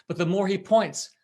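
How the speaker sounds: ordinary delivery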